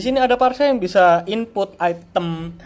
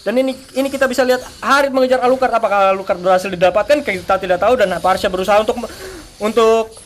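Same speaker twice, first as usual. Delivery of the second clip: live play-by-play; 4 semitones higher, 9 dB louder, faster than usual. Real, not acted.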